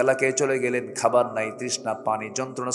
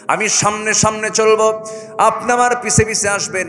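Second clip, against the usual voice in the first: high, loud sermon chant; full-force preaching; 10 semitones higher, 7 dB louder than usual. Acted. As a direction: preach it to the crowd.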